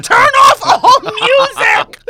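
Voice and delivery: high, slow, shouted villain decree; cartoon villain. Woman mocks herself as music-hating villain, drawn-out high shout. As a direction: boom it out like a hammy cartoon baddie. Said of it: "a fucking cartoon villain".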